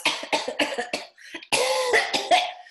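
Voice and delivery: high choking splutter; choking cough. Acted.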